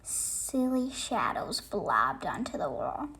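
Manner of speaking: usual speaking voice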